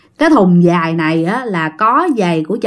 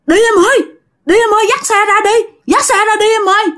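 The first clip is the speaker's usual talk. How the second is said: panicked urging; 11 semitones higher, faster than usual. Retold or imitated. Husband flees in panic.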